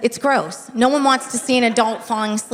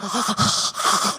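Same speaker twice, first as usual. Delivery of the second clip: sharp snoring gasp; 4 dB quieter than usual. Retold or imitated. Husband falling asleep, sharp gasping snore. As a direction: imitate a startled sleep gasp. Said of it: "He's like".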